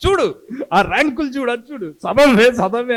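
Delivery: high, loud scolding shout; father yelling. Retold or imitated. Father pointing at rank ads, shouting at daughter to study. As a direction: yell at the kid to look and study. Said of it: "అరుపులకి మా నాన్న రోజు".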